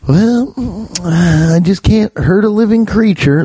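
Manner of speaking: high-pitched mock softie voice; tender-hearted softie. Mocking a dude who spares animals, precious softie.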